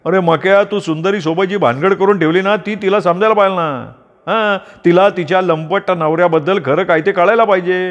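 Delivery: a busybody uncle wagging his finger; meddling old uncle. Acted.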